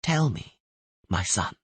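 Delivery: calm a dying man gently; gentle soothing voice. Acted. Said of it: "the gentle voice kept repeating".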